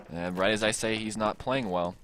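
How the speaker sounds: ordinary talk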